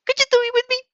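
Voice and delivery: high, loud hopeful plea; pleading. Acted. Shy girl begging her friend to join, high plea. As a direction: beg your friend, high and eager.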